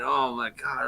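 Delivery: usual speaking voice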